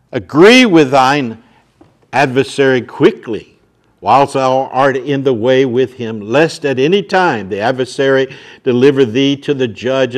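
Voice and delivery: loud, ringing pulpit voice; booming sermon delivery; full-force preaching. Acted. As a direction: hammer the warning home to the crowd. Reads long scripture passage loudly.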